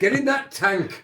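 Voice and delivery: laughing. Real, not acted. Joking on the office tour.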